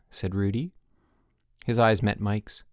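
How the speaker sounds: ordinary delivery, nothing marked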